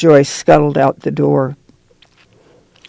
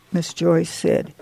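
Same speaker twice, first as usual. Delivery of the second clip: plain reading voice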